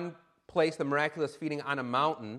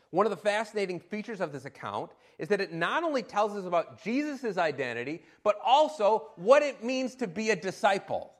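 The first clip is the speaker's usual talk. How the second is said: usual speaking voice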